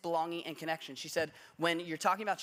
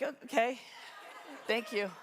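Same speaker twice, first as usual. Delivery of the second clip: awkward laugh; 6 semitones higher than usual. Retold or imitated. Awkward reply to odd compliment.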